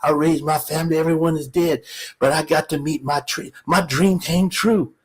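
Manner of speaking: put-on soft, moved elderly fan; old man. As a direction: pour it out quietly, awed to meet the hero. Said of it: "And the old man looks up".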